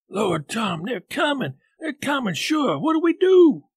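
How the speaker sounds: high frightened voice rising to a cry; terrified boy, voice climbing; panicked. Acted. Huck panics as figures approach.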